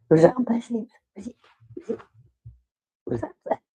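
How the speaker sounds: high, playful questioning of the dog; teasing the pet in a high voice; dog-talk voice. Pretend. Owner teasing her dog in a high voice, then trailing off.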